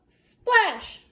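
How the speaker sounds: ordinary delivery, nothing marked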